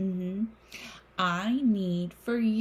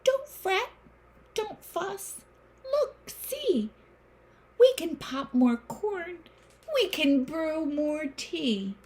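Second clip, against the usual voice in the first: very high squeaky mouse voice; 9 semitones higher than usual. Acted. Mouse soothes the upset bear, high squeak. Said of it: "Mouse squeaks".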